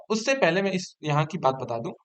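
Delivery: ordinary talk, nothing marked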